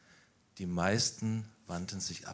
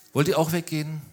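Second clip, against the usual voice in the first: louder and higher, putting the question straight to them; loud direct question; 7 semitones higher, 7 dB louder, faster than usual. Real, not acted. Preacher challenges the students with Jesus' question, loud and high. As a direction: call it out to the room.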